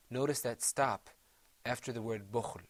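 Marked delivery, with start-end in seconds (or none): none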